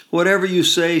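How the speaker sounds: high, loud wail. A narrator's reading. Mother wailing at her daughter, high and loud. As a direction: wail it out in despair. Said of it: "she wails".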